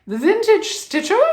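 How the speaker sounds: Vintage Stitcher in a high, loud, put-on British accent; playful British impression of The Vintage Stitcher; mock British accent. Shown as a demonstration. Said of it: "is much easier for me to say".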